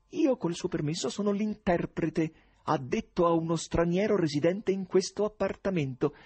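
acuto, lento, sornione; Korofiev imperturbabile. Acted. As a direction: tira in lungo senza turbarsi. Voce di Korofiev. (Korofiev risponde al presidente, acuto e sornione)

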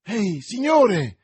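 loud, high, drawn-out hail; calling out. A narrator's reading. Stableman running after a stranger, calling out. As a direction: holler after someone leaving. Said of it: "esclamò uno stalliere rincorrendo lo sconosciuto".

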